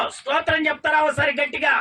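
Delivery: preacher calling for praise; rallying the crowd. Real, not acted. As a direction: stir the congregation to shout. Preacher rallies congregation.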